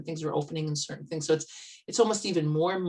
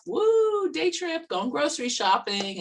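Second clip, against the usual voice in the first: mock cheer; 11 semitones higher, 4 dB louder than usual. Mock excitement suiting up.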